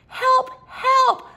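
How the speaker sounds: high, loud, laughing mock cry for help. Stuck little truck calling everyone, crying for help. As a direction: call for help in play panic. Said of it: "cried the Little Blue Truck".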